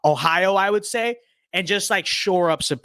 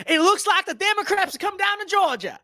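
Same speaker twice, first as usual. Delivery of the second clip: high mock voice. Game event read high.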